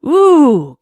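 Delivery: high, loud falling "ooh" howl; piercing eerie howl; monster shriek. Acted. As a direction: shriek like an attacking creature. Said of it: "came the shriek from the thing".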